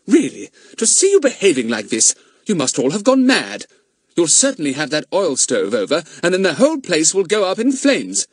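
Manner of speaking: lightly voiced mother telling off her son; a hint of a cross mother; mother scolds the boys for their mess; scolding mother. A narrator's reading.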